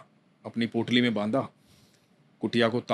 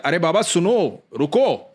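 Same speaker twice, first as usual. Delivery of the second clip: put-on higher, louder call to the old man; boy calling out; 4 semitones higher, 3 dB louder, slower than usual. Cowherd boy calling after the devotee to stop, loud. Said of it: "फिर बोलता है".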